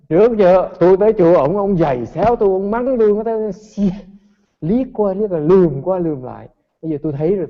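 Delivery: mock vengeful rant. Student's vengeful rant at master.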